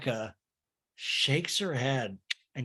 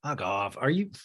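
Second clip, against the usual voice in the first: ordinary delivery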